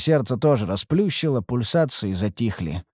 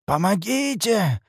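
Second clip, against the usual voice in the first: hoarse voice; 8 semitones higher, slower than usual. Acted. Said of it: "раздался хриплый голос".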